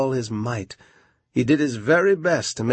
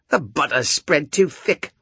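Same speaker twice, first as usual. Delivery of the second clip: gruff carpenter; 4 semitones higher, 3 dB louder than usual. Acted. Carpenter grumbles.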